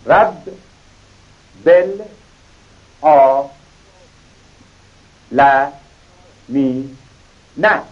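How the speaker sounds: each syllable apart with a pause between; chopped into syllables for a child. Shown as a demonstration. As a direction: read رب، بل، آ، ل، می، نه as separate beats.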